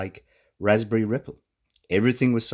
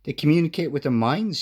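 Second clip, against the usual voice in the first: high, louder excited cry; excited shout; 8 semitones higher, 4 dB louder than usual. A narrator's reading. Rooster telling Pop about the mind-talking creatures, excited. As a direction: blurt it out in wonder. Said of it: "shouted Cocky excitedly".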